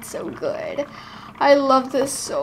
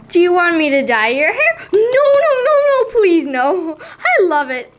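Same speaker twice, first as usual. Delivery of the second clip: panicked squealing; 6 semitones higher, 5 dB louder than usual. Acted. Doll panics about hair dye.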